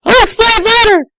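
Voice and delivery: put-on high, fast girlish voice; Tails, sweet and eager; young girl Tails. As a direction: chirp it quickly like a young fox girl. Tails on the phone.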